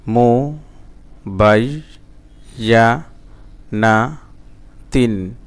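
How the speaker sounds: "মুবাইয়ানাতিন" very slowly, a pause after every syllable; broken syllable-by-syllable. Shown as a demonstration. Teacher showing broken pronunciation to learners, syllable by syllable. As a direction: chop "মুবাইয়ানাতিন" into separate drawn-out syllables. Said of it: "ভাঙা ভাঙা এবং reading উচ্চারণ".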